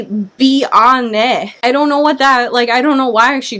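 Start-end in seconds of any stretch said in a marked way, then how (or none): 0.0-1.5 "bi ane" split into two parts with a stray a; mock the garbled "bi ane"; wrong pronunciation. Shown as a demonstration. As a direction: say "bi ane" as the author misspells it. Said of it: "it wouldn't be".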